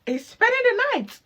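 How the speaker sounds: high, loud mock-disappointed oh; mock dismay. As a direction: fake a cheerful okay over letdown. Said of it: "And I'll be like".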